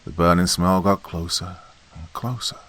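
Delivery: ordinary delivery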